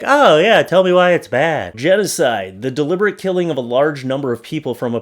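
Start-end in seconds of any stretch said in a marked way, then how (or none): 0.0-1.8 mock dumb reader. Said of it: "thinks like".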